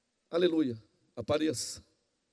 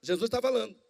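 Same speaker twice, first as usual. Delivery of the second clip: usual speaking voice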